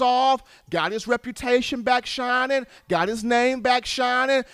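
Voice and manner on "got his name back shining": ordinary talk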